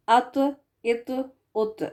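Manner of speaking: ات with a heavy bounced ط; heavy qalqala ta. Shown as a demonstration. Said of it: "Examples with تو". (قلقلہ کے ساتھ موٹا ط)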